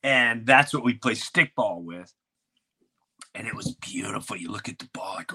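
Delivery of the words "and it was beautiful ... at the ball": hushed, breathy wonder; awed whisper. Real, not acted. Telling friends about a brand new ball, in an awed whisper. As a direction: whisper it like something precious.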